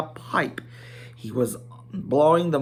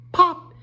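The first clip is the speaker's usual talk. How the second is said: pop sound; 7 dB louder than usual. A narrator's reading. Smoke ring popping.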